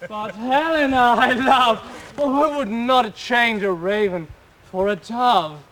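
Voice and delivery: a lover's loud vow; ardent declaration. Acted. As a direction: proclaim your love for all to hear. Lysander declaring love loudly.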